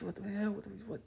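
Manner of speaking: high-pitched mock girlfriend voice; upset girlfriend. Girlfriend driving him to hospital, mock upset scolding. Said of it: "She's like".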